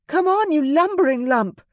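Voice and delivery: high, loud defiant jeer; mock the beast to lure it; defiant taunt. A narrator's reading. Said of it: "her tone defiant".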